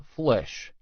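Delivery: plain reading voice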